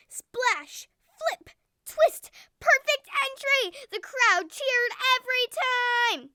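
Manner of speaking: high, punchy exclamations; storyteller hyping the divers for young listeners; excited narration. A narrator's reading. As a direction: call out each dive like a thrilling show. Narrating dazzling dives to children.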